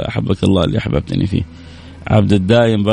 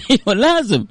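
high laughing firm answer; laughing reply; 13 semitones higher than usual. Retold or imitated. Host voicing the artist, laughing at the joke. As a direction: laugh through the insistence.

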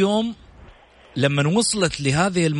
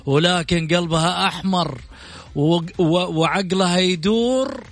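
announcer style; 5 semitones higher than usual. Acted. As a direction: build the guest up grandly. Long musical intro of the guest.

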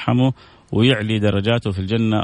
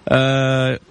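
usual speaking voice

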